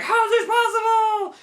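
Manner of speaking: very high, loud mock wail; dying villain's disbelief. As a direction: wail it like a defeated cartoon villain.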